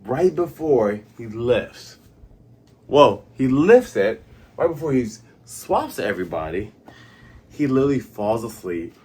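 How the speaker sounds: ordinary talk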